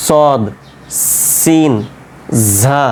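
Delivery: সদ, সিন, ঝা with tongue on the ridge behind the teeth; drawn-out সদ, সিন, ঝা, each sounded from the gum ridge; articulation demo. Shown as a demonstration. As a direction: say সদ, সিন, ঝা one by one, tongue at the tooth roots. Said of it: "ও জায়গাতে জিহ্বাটা গিয়ে ঠেকবে".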